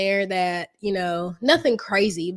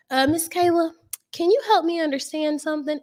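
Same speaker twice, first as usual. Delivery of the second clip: higher, lightly voiced gentle supervisor; imitating her supervisor; 8 semitones higher than usual. Coach imitating her nursing supervisor Jan asking a question, in a higher voice. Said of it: "But she would say".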